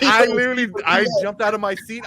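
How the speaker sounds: high, laughing burst of excitement; shocked movie fan laughing while retelling the scene; laughing excitedly. Real, not acted. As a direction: laugh as you relive the jolt.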